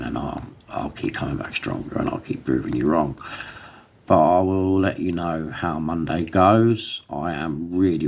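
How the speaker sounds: usual speaking voice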